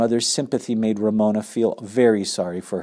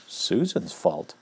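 wry aside; 4 semitones lower, 8 dB quieter, slower than usual. A narrator's reading. Narrator mockingly repeats the excuse.

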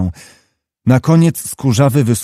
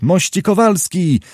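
high call across the field, hands cupped; 6 semitones higher than usual. A narrator's reading. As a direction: call to a rider far off, pitch raised. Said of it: "złożył ręce koło ust i począł wołać".

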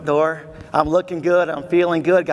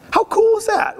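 much higher, louder burst of delight; excited; 4 dB louder than usual. Real, not acted. Speaker thrilling the audience, excited high exclamation.

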